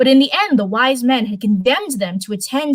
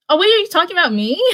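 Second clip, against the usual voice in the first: high, laughing surprise; 11 semitones higher, faster than usual. Real, not acted. Streamer laughing at a chat message, in high laughing surprise.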